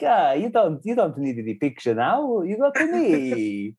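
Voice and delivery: hammed-up Italian accent, swooping high pitch; mock Italian footballer, drawn out; a jokey Italian accent, slowed at the end. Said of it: "You can always do an Italian accent".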